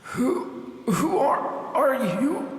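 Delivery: high, tearful stammer; terrified of the idol; trembling question; fearful stammer. Acted. Hero afraid.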